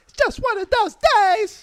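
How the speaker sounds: mock whine. Mock lament, far high.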